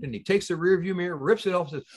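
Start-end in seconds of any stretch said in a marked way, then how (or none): none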